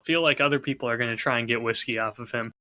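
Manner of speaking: ordinary delivery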